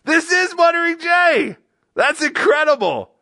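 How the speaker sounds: high, loud whooping excitement; hammed-up shout of astonished delight; mock amazement. Mock amazement at guest's answer.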